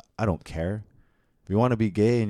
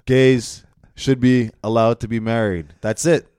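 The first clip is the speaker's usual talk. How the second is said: emphatic declaration; 5 semitones higher, 11 dB louder than usual. Real, not acted.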